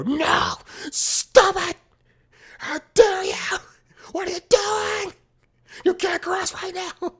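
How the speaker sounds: very high, loud imitation of scolding workers; mock outraged steward. Event workers stopping people crossing the road, hammed-up high screeching.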